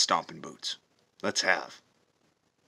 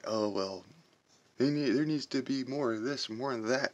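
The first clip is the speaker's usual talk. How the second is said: higher mocking whine of the critics; mock them in a higher, whiny voice; mocking quote; 5 semitones higher than usual. Veteran mocking what critics say, higher and whiny. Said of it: "I've heard a lot".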